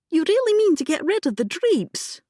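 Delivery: high, amazed question; girl astonished at the idea; surprised. A narrator's reading. Younger sister amazed at drapes.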